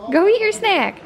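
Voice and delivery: high coaxing voice for a dog; pet-talk voice. Real, not acted. Owner urging her dog to eat a snack, pet-talk voice.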